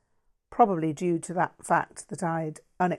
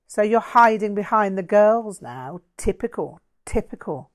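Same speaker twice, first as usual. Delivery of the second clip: louder, higher mocking jeer; 4 semitones higher, 7 dB louder than usual. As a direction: taunt him for hiding behind others. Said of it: "Todd jeered".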